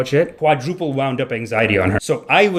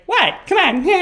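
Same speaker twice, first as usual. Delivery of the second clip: mock girlfriend; 14 semitones higher than usual. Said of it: "and she was like".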